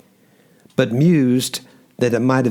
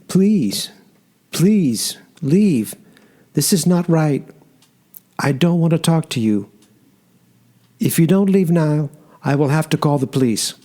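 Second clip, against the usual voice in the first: higher, tearful and halting; crying, halting; 5 semitones higher than usual. Acted. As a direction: plead through tears, words breaking up. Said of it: "came out haltingly because of the crying".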